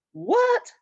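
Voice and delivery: a sharp, high startled "What?"; startled question. Acted. Rachel turning around at the bus line, startled. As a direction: spin round in disbelief. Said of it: "asked Rachel as she turned around".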